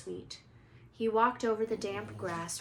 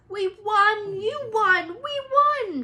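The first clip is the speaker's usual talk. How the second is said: much higher, much louder joyful yell; shouting; 12 semitones higher, 14 dB louder, slower than usual. Acted. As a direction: yell the victory. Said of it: "shouted Ron".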